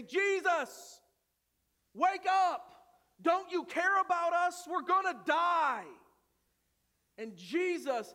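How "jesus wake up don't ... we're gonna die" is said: loud, desperate pleading; panicked disciples. Acted. Disciples begging sleeping Jesus in the storm, loud panic. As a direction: shake him awake, they're terrified. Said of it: "they say".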